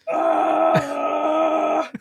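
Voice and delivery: high anguished scream. Retold or imitated. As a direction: let out a wild anguished howl. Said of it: "just screaming".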